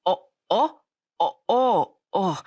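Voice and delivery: freeze up in surprise on each syllable; startled stammer. A narrator's reading. Boy spotted by a classmate at his father's shop, startled. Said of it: "너무 놀라 순간 얼음이 되어버리고".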